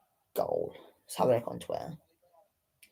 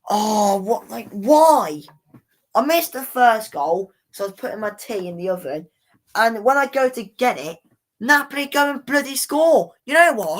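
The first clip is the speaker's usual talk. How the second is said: raised, higher voice of real frustration; worked-up, high-pitched complaining; exasperated rant; 6 semitones higher, 5 dB louder than usual. Real, not acted. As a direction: rant in loud disbelief at your bad luck. Ranting about the missed goals.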